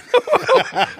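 high falsetto burst of laughter. Real, not acted. Friend laughs at his own joke, high laugh. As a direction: crack up high.

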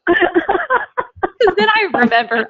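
laughing through the words, high and bright with amusement. Real, not acted. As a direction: chuckle your way through it, pitch way up. Laughing at her own joke.

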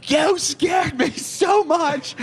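high, drawn-out mock shriek; hammed-up scared wail; imitating frightened friend. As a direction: squeal it like a badly startled friend. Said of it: "and she's like".

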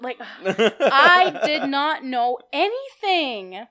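ordinary talk

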